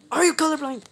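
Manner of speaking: shouting at a blind teammate; exasperated shout. Real, not acted. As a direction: yell it high and loud in disbelief.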